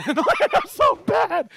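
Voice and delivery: high, slow laugh through the words; laughing at himself. Real, not acted. Host laughing at his failed pronunciation to viewers. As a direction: giggle at your own blunder.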